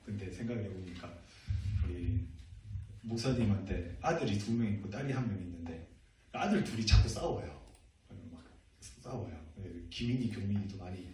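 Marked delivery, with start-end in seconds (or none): none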